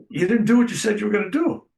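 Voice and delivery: high, fast, accusing imitation of the wife; wife confronting. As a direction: rattle off the accusation at the doctor, pitched high.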